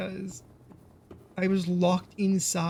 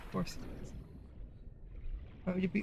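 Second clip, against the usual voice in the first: a sour little aside to yourself; sarcastic whispered aside; 13 dB quieter, faster than usual. Real, not acted. Streamer grumbling about the lagging stream in a sarcastic whisper. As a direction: whisper it under your breath, dry and resigned.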